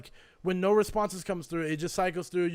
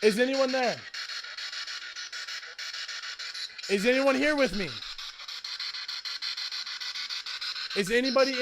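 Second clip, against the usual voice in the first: calling out to spirits; 5 semitones higher than usual. Acted. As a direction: call out into an empty room. Asking the spirit box questions.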